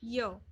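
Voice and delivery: य as a lone "yuh" syllable; short, separate य to show the starting sound; letter Y sound. Shown as a demonstration. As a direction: give य as the phonics sound of Y. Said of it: "starting with the same sound".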